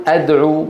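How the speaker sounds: أدعو started with a, "ad'u"; wrong opening vowel. Shown as a demonstration. Teacher showing what we do not say, with fatha. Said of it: "ولا نقول".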